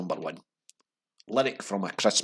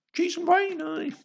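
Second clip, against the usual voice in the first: much higher, squeaky mocking voice; mock posh squeal; 5 dB louder, slower than usual. Host mocking the prime minister's parties in a squeaky voice. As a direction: squeak it like a silly toff.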